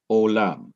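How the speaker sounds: "Olam" accented on its last syllable, LAM; final-syllable stress. Shown as a demonstration. Teacher repeating the spelled-out word for students, "Olam" stressed on LAM. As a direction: put the weight on LAM in "Olam". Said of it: "the last syllable, ... get the accent".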